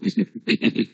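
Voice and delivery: short breathy chuckle; soft laugh. Real, not acted. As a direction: laugh it off lightly. Laugh after student compliment.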